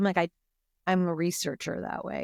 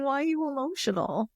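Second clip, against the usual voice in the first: higher, put-on voice of a dismissive critic; mocking dismissive quote; 7 semitones higher than usual. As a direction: mimic someone brushing off her tears. Mimicking someone dismissing her feelings.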